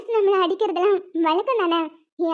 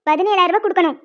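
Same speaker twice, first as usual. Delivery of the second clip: ordinary talk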